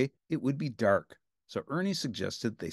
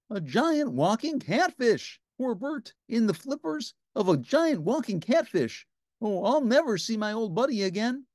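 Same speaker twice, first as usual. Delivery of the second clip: despairing Ernie; 10 semitones higher than usual. A narrator's reading. Ernie mourning Bert taken by the catfish, hammed-up despair. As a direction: moan over the lost buddy.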